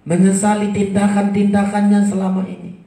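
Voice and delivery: high, loud preaching; emphatic preaching. Acted. Preacher describing the weeping woman, loud preaching. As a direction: drive the point home.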